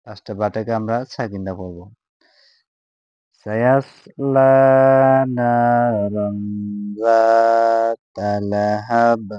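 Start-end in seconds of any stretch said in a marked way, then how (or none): 3.5-9.3 slow, drawn-out chanted recitation; slow tajweed recitation in Arabic; melodic Quran recitation. Shown as a demonstration. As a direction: chant each syllable long and sung.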